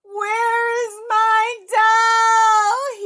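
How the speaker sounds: very high, very loud, slow sing-song cry; lost boy howling for his doll; wailing yell. Acted. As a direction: wail it to the sky. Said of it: "he yelled out loud".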